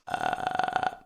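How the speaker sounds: low, creaky fry sound; vocal fry. Shown as a demonstration. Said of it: "it's this".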